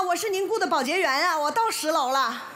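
usual speaking voice

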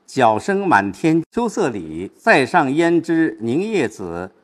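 slow recitation with 凝夜子 weighted; measured recitation. Shown as a demonstration. Teacher reciting the couplet to show where the weight falls. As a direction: read slowly, building weight toward the end. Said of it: "我们把它不是一样重".

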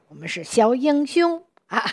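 lifted, proud little-hero voice; 自豪自信地说; proud and confident. Shown as a demonstration. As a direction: sing it out like a proud young hero. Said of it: "自信的啊，非常光荣的这样的感觉".

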